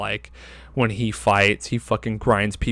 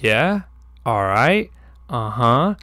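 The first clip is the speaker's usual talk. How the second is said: unimpressed; slower than usual. Retold or imitated. Unbothered replies to rival's threats.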